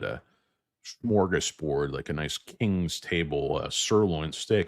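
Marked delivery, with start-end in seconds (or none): none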